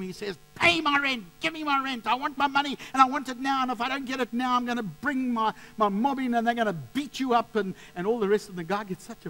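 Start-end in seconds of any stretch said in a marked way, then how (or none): 0.6-7.5 high, loud mock rant of a bullying landlord; hammed-up angry yelling, high-pitched; angry shouting landlord. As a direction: shout demands as the old landlord. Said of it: "and he shouts at him".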